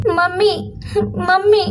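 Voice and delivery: high-pitched daughter voice, pleading to mummy; acted high voice of an upset daughter; complaining daughter.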